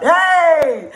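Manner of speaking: high, loud drawn-out cheer; excited cheer. Real, not acted. Host greeting Paul as he turns up, real loud cheer. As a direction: cheer a friend's arrival.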